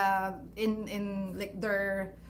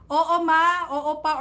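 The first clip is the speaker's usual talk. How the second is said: respectful reply; 6 semitones higher, 8 dB louder than usual. Retold or imitated. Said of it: "We are".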